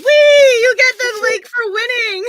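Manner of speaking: high, loud gleeful cheer; excited squeal. Real, not acted. Host cheering over a party popper, loud and high.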